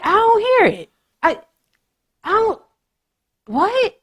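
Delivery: very high, squeaky-pitched mock confusion; baffled. Viewer turning up the volume, acting baffled. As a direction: act out straining and failing to hear. Acting out straining to hear accents.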